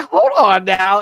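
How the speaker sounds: high, loud mock disbelief; a loud, high hold-everything beat; stunned reaction. Hearing the priest's rain talk, loud mock disbelief. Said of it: "I was like".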